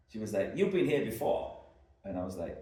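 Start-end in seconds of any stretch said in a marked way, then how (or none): 0.6-1.6 raised, loud imitation of a Bostonian; horrible Bostonian accent. Barmaid quizzing a returning customer, mock Boston accent. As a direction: put on a bad Boston barmaid voice. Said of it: "my horrible Bostonian accent".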